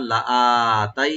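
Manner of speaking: লাআতাই with আ held very long; stretch demo. Shown as a demonstration. Teacher recalling the earlier stretch for students. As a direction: drag the আ of লাআতাই. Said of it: "লাআতাইনা".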